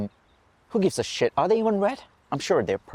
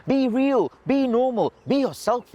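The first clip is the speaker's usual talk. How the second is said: high-pitched imitation of other people's advice; mimicked nagging advice; 8 semitones higher, slower than usual. Quoting what they say to the speaker, mock nagging. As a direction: parrot the advice in a raised, mocking pitch.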